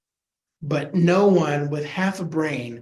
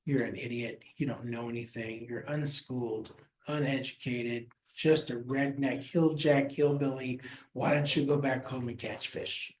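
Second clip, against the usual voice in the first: much softer and lower, scornful put-down; quiet contempt; 3 semitones lower, 8 dB quieter than usual. Retold or imitated. Jerusalem elites sneer at a Galilean, soft and scornful. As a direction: murmur the insults low and dismissive. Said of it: "it just says".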